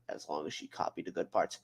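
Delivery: low, hushed afterthought; acted quiet aside; muttered aside. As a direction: drop it right down, under the breath. Aside within Cortana's acted line.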